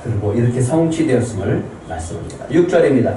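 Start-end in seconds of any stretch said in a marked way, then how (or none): none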